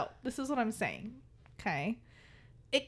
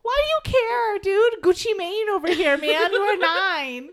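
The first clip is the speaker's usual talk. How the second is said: loud, high mock rant; exasperated scolding; 8 semitones higher, 10 dB louder than usual. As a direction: scold in comic disbelief. Replaying exasperated scolding of kid.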